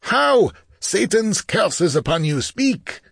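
high, harsh shouted command; furious demand. Acted. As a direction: shake the answer out of him. Chief shakes dying man.

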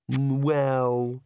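drawn-out, reluctant murmur; grudging. A narrator's reading. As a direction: give in reluctantly.

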